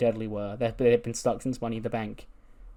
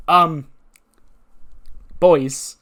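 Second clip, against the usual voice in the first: loud, higher attention-getter; pointed interruption; 5 semitones higher, 9 dB louder than usual. Retold or imitated. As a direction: cut into the celebration with pointed pauses. Tonya interrupts the celebration.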